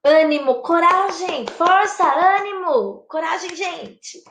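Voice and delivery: high, rousing pep talk; cheering the group on, word by word; encouragement. Shown as a demonstration. Demonstrates interjections of encouragement.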